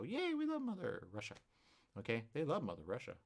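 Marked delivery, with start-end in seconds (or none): 0.0-1.3 mock cheer. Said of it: "So they go".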